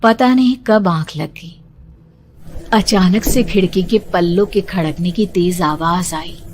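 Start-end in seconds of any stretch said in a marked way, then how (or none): none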